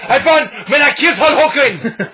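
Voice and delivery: Iron Sheik impression. Voicing the Sheik.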